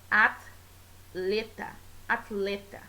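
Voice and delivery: "atleta" broken slowly as at... leta; "atleta" with a detached first syllable, at; split between t and l. Shown as a demonstration. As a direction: pause after the t in "atleta". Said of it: "pronunciar de uma maneira um pouco peculiar".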